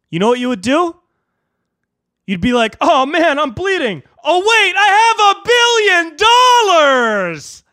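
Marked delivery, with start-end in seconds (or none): 2.8-7.6 high alarm building to a huge holler; very high, drawn-out gleeful yell; mock realization. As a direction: go from hurt to belting triumph, stretching the end. Acting out stabbed billionaire's joy.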